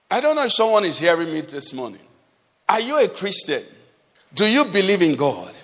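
normal talking voice

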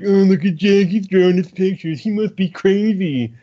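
high, put-on mocking voice of gossiping people; mocking townsfolk. Friend mocks the movie's townsfolk in a high put-on voice. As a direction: sneer it like a small-minded neighbour. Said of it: "everyone's doing the prototypical".

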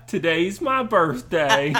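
put-on slow, loud, drawn-out reality TV girl; reality show girl impression. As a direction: announce it like a reality contestant. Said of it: "spot-on impression of this girl".

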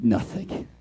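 low breathy mock whisper; whispered nothing. Kids feigning they heard nothing, whispered. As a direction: barely voice it, playing innocent. Kids pretending not to hear.

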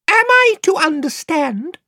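very high, piping, courtly little voice; shrill piping mouse. Acted. Reepicheep greeting Queen Lucy in a piping voice. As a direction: pipe it like a tiny gallant knight.

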